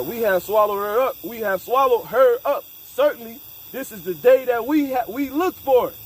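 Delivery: a street preacher working up to a climax; building preaching. Acted. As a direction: drive the verse home, climbing. Verse read climbing in force.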